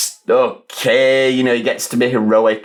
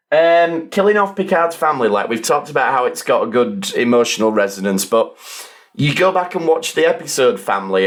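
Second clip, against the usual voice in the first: ordinary delivery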